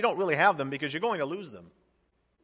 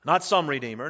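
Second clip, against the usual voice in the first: normal talking voice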